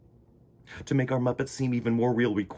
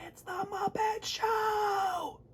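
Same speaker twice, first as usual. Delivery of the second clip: much higher, drawn-out cry; awed exclamation; 6 dB quieter, much slower than usual. Acted. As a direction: marvel at the show. Awed stretched shout.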